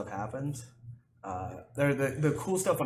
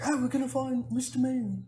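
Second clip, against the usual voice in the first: much higher, put-on eager streamer voice; imitating the streamer; 13 semitones higher than usual. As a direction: play the streamer all excited about the hunt. Streamer quoted in retold clip.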